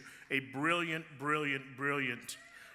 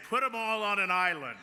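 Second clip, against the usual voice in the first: mocking quote; 6 semitones higher, 6 dB louder than usual. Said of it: "those people that say".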